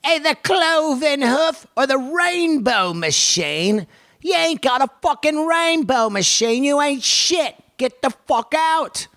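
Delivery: high, mock-outraged British heckler; put-on British voice. Sponsor read turned into a skit, high mock British put-down. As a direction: sneer it in a high, hammed-up British voice. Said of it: "my voice goes to that one".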